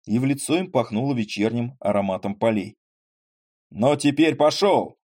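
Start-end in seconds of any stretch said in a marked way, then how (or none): 3.7-5.0 slow, loud, higher roar. Acted. Retired ensign roars at the troika horses. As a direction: bellow it out to the horses. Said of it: "заревел отставной прапорщик".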